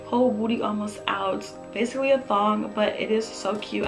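ordinary talk

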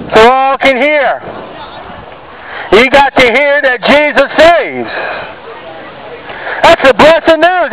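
ordinary talk, nothing marked

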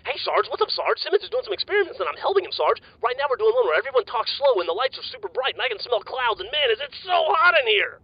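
way too hyped to sit still; wired, insisting he's calm. Acted. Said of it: "it's sort of malfunctioning right now".

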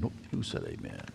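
very low, soft grumble; hushed disapproval. Acted. Churchgoer objecting to somebody's amen, hushed disapproval. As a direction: grumble it under your breath.

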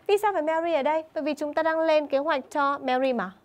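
usual speaking voice

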